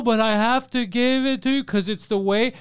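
high, slow mock protest; insistent rose seller. Rose seller refusing free money in a retold story, insistent. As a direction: imitate a stubborn pleading vendor.

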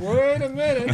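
high laughing wait a minute; getting a surprise gift, laughing excitedly. Real, not acted.

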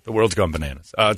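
ordinary talk, nothing marked

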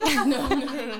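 real laughing, embarrassed no; laughing denial. Real, not acted. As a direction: giggle out a flustered protest.

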